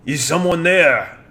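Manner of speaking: put-on slow French-accented call from under rubble; muffled plea in a French accent; french chef. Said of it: "It goes".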